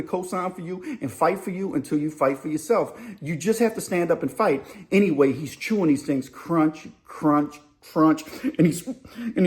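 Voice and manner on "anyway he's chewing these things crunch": usual speaking voice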